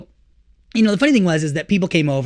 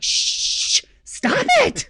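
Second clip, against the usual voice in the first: mock hush; much slower than usual. Husband hushes wife pointing out flaws, playful. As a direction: hiss then higher stop it.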